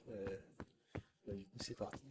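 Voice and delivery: hushed voice so no one wakes; speaking quietly. Real, not acted. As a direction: keep it down, late at night. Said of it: "je peux pas parler fort".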